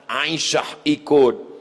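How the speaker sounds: ordinary delivery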